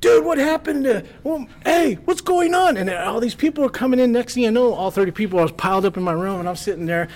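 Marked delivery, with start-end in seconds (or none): 0.0-2.8 high, loud excited questions; nosy partygoers. Retold or imitated. Party guests crowding in, high loud eager questions. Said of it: "they're like".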